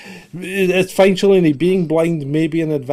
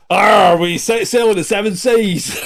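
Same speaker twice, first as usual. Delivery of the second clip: loud, higher hammed-up pirate cry; mock pirate; 3 semitones higher, 7 dB louder than usual. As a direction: growl "arr" like a comic pirate, then call out. Jokes about piracy as sailing seas.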